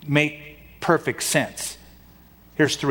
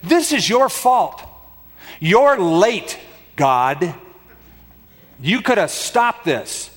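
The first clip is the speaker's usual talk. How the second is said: high-pitched, blaming outburst; mock accusation; 5 semitones higher, slower than usual. Martha blaming God for arriving late, voiced as reproachful accusation. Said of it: "But what she is saying is this".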